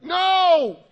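high, very loud holler back; re-enacted yell upstairs, at full blast; hollered shout. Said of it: "I hollered back".

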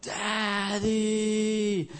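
high, long-drawn mock wail; stretched-out, high-pitched cry for a parent; child's scared call. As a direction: imitate a little boy calling out from a nightmare. Child waking in a cold sweat.

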